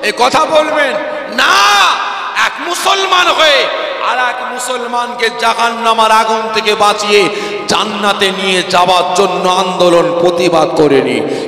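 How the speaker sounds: high, loud, relentless sermon shouting; fiery preaching. Acted. Preacher shouting at the congregation in a full-force sermon. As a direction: preach it at full force, hammering each sin.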